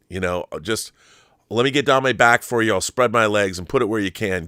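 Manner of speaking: usual speaking voice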